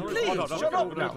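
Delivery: exasperated. Acted. Exasperated Ceann Comhairle.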